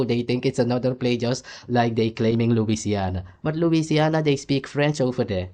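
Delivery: ordinary delivery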